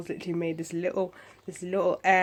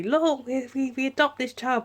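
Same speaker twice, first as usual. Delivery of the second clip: higher, louder mocking imitation; mocking boast; 8 semitones higher, 5 dB louder than usual. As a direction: put on the parents' showy announcement, mockingly. Mocking the adoptive parents.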